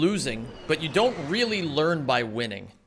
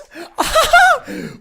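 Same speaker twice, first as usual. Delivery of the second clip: very high, loud burst of laughter; triumphant shriek-laugh; 10 dB louder than usual. Real, not acted. Streamer celebrating getting in, loud high cackle.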